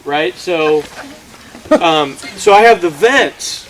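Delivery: usual speaking voice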